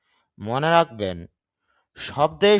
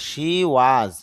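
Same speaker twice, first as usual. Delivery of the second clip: she was said very slowly; drawn out for learners; slow teacher model; slow demo; 4 dB louder than usual. Shown as a demonstration. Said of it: "teacher demo".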